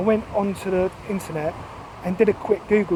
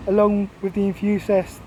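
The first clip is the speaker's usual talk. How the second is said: ordinary delivery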